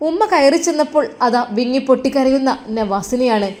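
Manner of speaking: ordinary delivery, nothing marked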